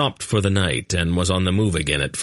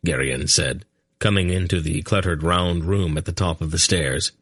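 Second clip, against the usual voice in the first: plain reading voice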